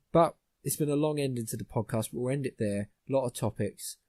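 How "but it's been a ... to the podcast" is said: ordinary talk, nothing marked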